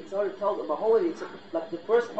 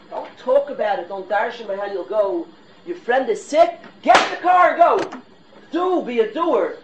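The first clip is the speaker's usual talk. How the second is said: loud, high, urgent push to act; fired-up exhortation; 4 semitones higher, 7 dB louder than usual. Real, not acted. Rabbi urging himself and listeners to be doers, fired up. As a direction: fire yourself and the room up, voice raised. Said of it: "trying to fire myself up".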